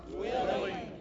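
the congregation murmurs willing; answered softly from the room; distant crowd answer. Real, not acted. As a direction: congregation reply, off mic.

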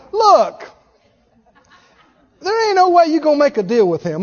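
ordinary talk, nothing marked

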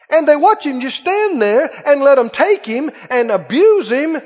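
high, urgent pulpit delivery; impassioned preaching. Real, not acted. Preacher recounting the night they came for Jesus, impassioned.

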